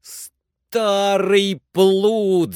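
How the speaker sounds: high, loud, long-drawn scolding cry; relieved shout. A narrator's reading. Master scolds climber once relieved, loud drawn-out shout. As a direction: stretch the insult out in relief. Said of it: "закричал Легран с видимым облегчением".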